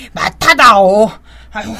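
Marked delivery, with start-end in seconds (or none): 1.5-1.7 hurried excuse. Acted. Palgae making excuses to flee.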